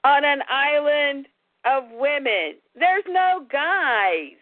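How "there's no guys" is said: very slow, deliberate; slow emphasis. Real, not acted. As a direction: drag out each word.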